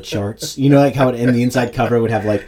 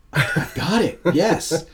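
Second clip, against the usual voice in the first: higher, thrilled I got it; excited eureka; 7 semitones higher, slower than usual. Retold or imitated. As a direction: exclaim like a sudden eureka. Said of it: "comes up and goes".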